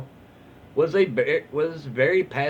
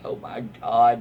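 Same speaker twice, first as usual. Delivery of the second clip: ordinary talk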